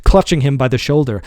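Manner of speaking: ordinary delivery, nothing marked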